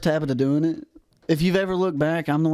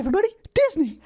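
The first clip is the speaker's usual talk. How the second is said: excited squeal; 14 semitones higher, faster than usual. Pretend. Echoes topic excitedly.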